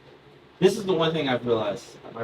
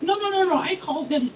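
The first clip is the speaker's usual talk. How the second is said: put-on very high kid voice, dropping softer; Tommy; 3 dB quieter than usual. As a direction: imitate a first-grader explaining a secret code word. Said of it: "He's like".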